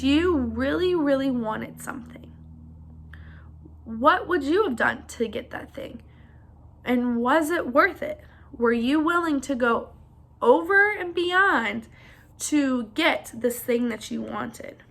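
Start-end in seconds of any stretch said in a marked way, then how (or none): 1.8-6.0 hushed question; teacher whispers a thinking question to the class. Real, not acted. 10.4-14.8 slow, drawn-out stress; teacher slows down to stress the question; slow emphasis. Real, not acted.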